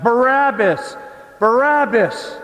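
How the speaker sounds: high, loud chanted shout; crowd shout. Retold or imitated. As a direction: yell the name like a roaring mob. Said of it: "the crowds shouted".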